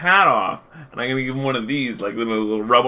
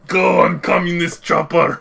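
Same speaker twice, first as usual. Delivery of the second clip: heroic call; 5 semitones higher, 4 dB louder than usual. Pretend. Acting out heist escape.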